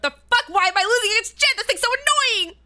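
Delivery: rising, exasperated rant; re-enacted angry outburst; retold mad. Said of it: "I was really mad 'cause I was like".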